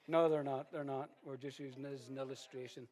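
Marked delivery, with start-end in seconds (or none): 0.1-1.1 loud denial. Real, not acted. Retracting joke insult, loud.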